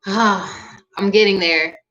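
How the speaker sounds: ordinary talk, nothing marked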